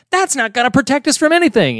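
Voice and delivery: high-pitched, quick mock protest; indignant Lisa. Lisa arguing with Homer about the bomb shelter, indignant.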